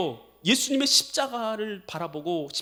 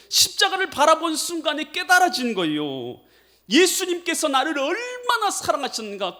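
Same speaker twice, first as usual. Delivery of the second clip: usual speaking voice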